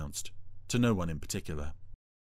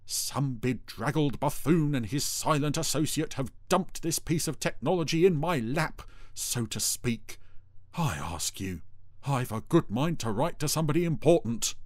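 pompous rant, pitched up; self-important, aggrieved ranting; indignant Dougal; 6 semitones higher than usual. A narrator's reading. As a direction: complain grandly, dropping for the asides. Dougal rants about the machine.

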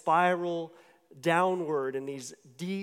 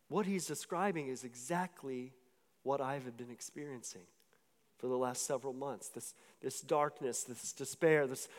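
low, soft, subdued realization; retold quietly, as if keeping tears in; hushed and heavy, struck by recognition; holding back tears; 4 semitones lower, 6 dB quieter than usual. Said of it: "trying to hold back tears".

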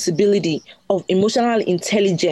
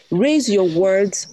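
slow quote reading; much slower than usual. Shown as a demonstration. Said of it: "this is a quote by Rumi".

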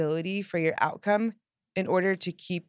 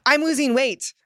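louder and higher, bright with energy; excited; 6 semitones higher, 7 dB louder than usual. Shown as a demonstration. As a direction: sell it with excitement. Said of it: "which phrase is more exciting".

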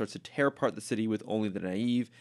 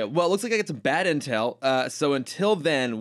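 usual speaking voice

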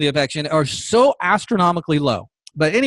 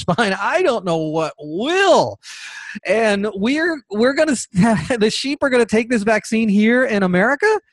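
normal talking voice

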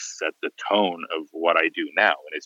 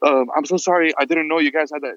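panicked apology; 8 semitones higher than usual. Retold or imitated. Embarrassed PR lady apologizes to artist, mock-panicked and high. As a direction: imitate a flustered PR lady caught out. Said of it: "she's immediately panicking".

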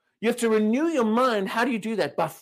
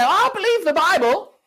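"I believe the Bible" up high and louder; mock believer; 9 semitones higher, 5 dB louder than usual. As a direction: mimic the self-assured believer. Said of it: "many people say".

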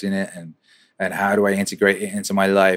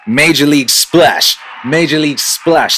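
hyped; 4 semitones higher, 6 dB louder than usual. Real, not acted. Host announcing his new song title, hyped. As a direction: sell the title like a big reveal.

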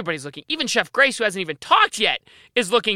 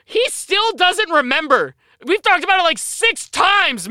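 high, slow exasperated complaint; 8 semitones higher, 3 dB louder, slower than usual. Real, not acted. Host exasperated at co-host forgetting again, high and slow. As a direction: spell out his disbelief at the forgetting.

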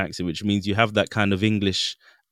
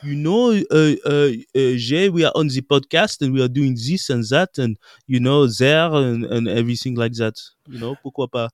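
hammed-up French accent, stretching the filler words; typical French accent. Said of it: "why don't you have the typical French accent?".

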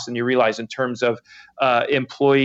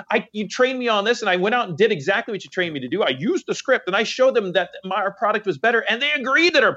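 pitched-up, exasperated retelling of the rep; frustrated sales rep; 6 semitones higher than usual. Retold or imitated. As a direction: complain like a rep who did everything right. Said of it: "But it's like".

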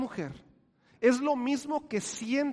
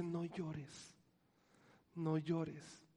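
tender comfort; 4 semitones lower, 14 dB quieter, much slower than usual. Acted. God comforting the grieving woman.

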